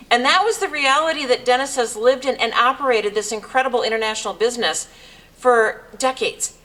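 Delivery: ordinary talk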